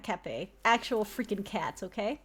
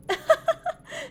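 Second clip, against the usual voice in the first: villain laugh; 5 dB louder than usual. Acted. Villain amused by cop.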